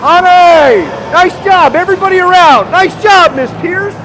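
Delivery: high, loud congratulations; excited cheering. Real, not acted. Announcer praising everybody after the goal, thrilled. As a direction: cheer the scorers with full delight.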